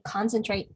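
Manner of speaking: "concentrate" as CON-cen-trate; first-syllable stress. Shown as a demonstration. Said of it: "the first syllable".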